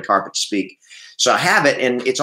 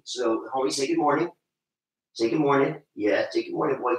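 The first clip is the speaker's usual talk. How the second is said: coaxing the dog; 3 semitones higher, 6 dB quieter than usual. Real, not acted. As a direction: gently urge the dog to say good morning. Coaxing the beagle to greet.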